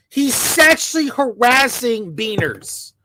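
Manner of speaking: ordinary talk, nothing marked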